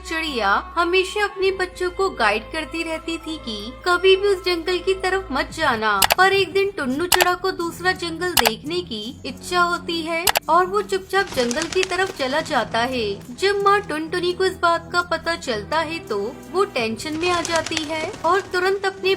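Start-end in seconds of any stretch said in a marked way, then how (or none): none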